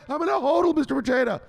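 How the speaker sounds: very high, pledging pupil voice; high-pitched keen answer; eager follower. Acted. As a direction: recite the pledge to your master. Follower repeats the answer, more eagerly.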